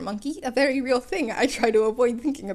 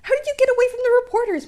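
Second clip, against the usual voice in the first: loud, high, fast eager question; excited question; 13 semitones higher, 9 dB louder, faster than usual. Acted. Midoriya excitedly asks All Might about the reporters. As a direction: blurt it out breathless with excitement.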